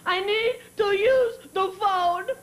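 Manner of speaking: loud, high, halting mock sobs; hammed-up tearful kid wail; crying child. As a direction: imitate a bawling little girl. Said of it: "twenty minutes later crying".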